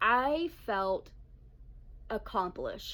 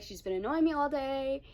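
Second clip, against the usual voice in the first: usual speaking voice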